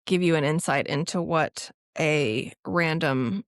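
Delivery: ordinary delivery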